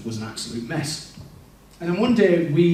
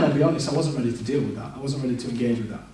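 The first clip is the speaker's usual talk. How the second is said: usual speaking voice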